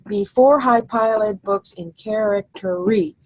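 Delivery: slow, each syllable counted out. Shown as a demonstration. Teacher counting the syllables of the line slowly. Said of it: "And then we have the next line".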